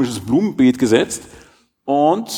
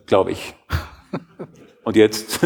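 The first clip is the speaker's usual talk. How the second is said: usual speaking voice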